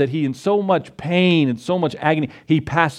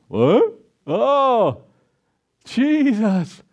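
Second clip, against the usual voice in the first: high, startled double-take at Jesus; startled gasp; 7 semitones higher, much slower than usual. Retold or imitated. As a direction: jump in surprise, voice leaping up. Thomas startled seeing Jesus behind.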